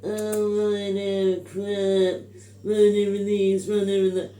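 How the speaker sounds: slow, high, droning mock whinge; mocking the complainers. Imitating people who slag off Ryanair, mocking whinge. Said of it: "people slag 'em off and say".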